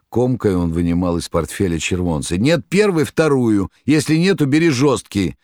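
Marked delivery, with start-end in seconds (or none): 2.4-5.4 much higher and louder, panicked; frantic shout. A narrator's reading. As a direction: yell it in blind panic. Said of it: "Римский крикнул".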